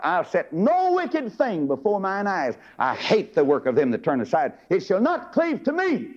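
the verse lifted high, slow and solemn; intoned vow. Acted. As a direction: proclaim it as David's solemn vow. David's vow, intoned by preacher.

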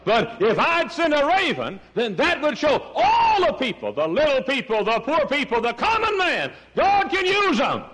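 full-force preaching. Acted. Preacher voicing God to Elijah, high impassioned delivery.

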